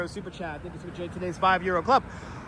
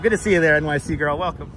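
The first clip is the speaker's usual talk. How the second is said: usual speaking voice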